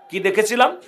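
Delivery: ordinary talk, nothing marked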